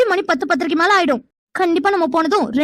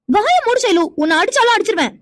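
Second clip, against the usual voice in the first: sharp, higher scolding outburst; angry; 4 semitones higher than usual. Acted. Meera scolding her stubborn friend, angry. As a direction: snap at her to shut up.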